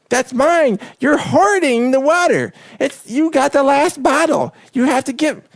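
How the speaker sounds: high, drawn-out mock outrage over water; fighting shopper. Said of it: "people are fighting you for the bottled water".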